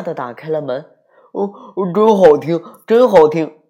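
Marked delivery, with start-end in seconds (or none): 1.3-3.6 loud excited praise; loud delight. A narrator's reading. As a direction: cheer it out loud. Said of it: "爸爸大声地说".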